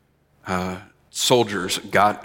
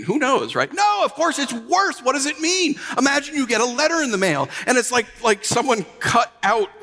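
higher, building preacher's fervor; preaching at full force; 13 semitones higher than usual. Acted. Preacher to church about unreadable threats, rising fervor. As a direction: press the terror home to the congregation.

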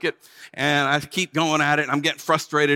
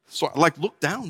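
ordinary delivery

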